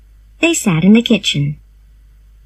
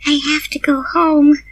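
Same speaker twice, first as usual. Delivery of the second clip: Diana's voice; 7 semitones higher than usual. A narrator's reading. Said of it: "she said".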